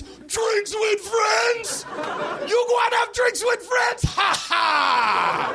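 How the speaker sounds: slow squeaky shouting; high yelling driver voice; high-pitched shouting cabbie. Retold or imitated. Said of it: "he went".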